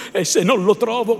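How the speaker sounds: loud and fast angry threat; loud rushed fury; blind with anger. A narrator's reading. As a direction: push on, deaf to reason. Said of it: "cieco affatto dalla collera".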